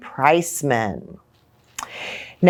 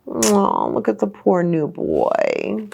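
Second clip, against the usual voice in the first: usual speaking voice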